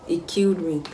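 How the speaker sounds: normal talking voice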